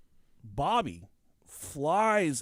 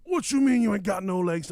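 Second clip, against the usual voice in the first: loud, high disbelieving driver; imitated incredulous yell; 6 semitones higher, 6 dB louder than usual. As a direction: throw the question out in mock alarm. Acted street quarrel, driver disbelieving.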